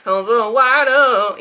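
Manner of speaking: a touch of mock fawning, very slow; acted exclamation. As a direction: stretch the words out loudly. Mimics wanting someone to exclaim.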